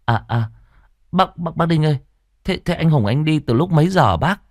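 put-on a nervous boy tripping over his words; stammering boy. A narrator's reading. (giọng cậu bé cao, lắp bắp)

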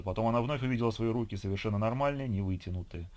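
ordinary delivery, nothing marked

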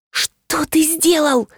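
high, slow shocked question; horrified at what the computer whiz sent; aghast gasp. A narrator's reading. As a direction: gasp it out in disbelief. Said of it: "ахнула я".

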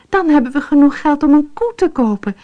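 high, fast, eager exclaim; racing ahead with her dream; excited cry. A narrator's reading. Fatima dreams of a cow, excited and fast. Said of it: "riep Fatima".

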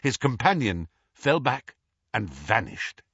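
ordinary delivery

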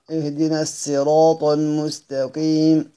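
slow recitation, الصراط said with a plain s; الصراط with seen in place of saad; reading with seen. Shown as a demonstration. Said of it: "بقراءة السين على الأصل".

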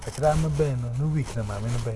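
usual speaking voice

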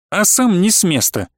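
ordinary delivery, nothing marked

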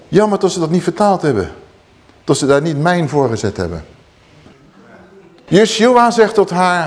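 normal talking voice

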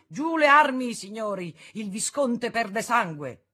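loud, high alarmed cry, slowing at the end; urgent shout. A narrator's reading. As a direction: stop them at once. Intervening to stop the duel.

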